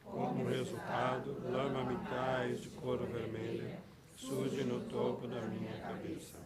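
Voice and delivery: soft, slow, even recitation of a sadhana; meditative text reading. Acted. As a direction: read it as a quiet visualization.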